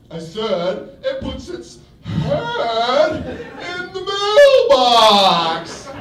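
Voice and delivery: slow, rising to a loud creepy yell; loud and aggressive. Acted. Man obeys her imagined wish, loud aggressive yell. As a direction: build from menace to a bellow. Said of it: "to be loud and aggressive".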